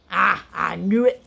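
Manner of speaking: loud Scottish warrior's roar. A narrator's reading. Spoon learns the wizard is rusty, roaring. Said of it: "roared Spoon".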